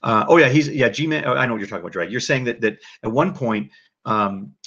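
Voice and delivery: ordinary talk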